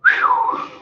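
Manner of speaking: sharp high exhale of relief; shaking off an intense reading with a whew. Real, not acted. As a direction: blow the tension out with a high whew. Exhale after dramatic moment.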